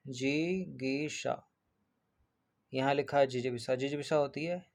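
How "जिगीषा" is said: जिगीषा stretched long and soft; slow drawn-out answer. Shown as a demonstration. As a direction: draw out जिगीषा slowly, softer. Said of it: "क्या कहलाती है".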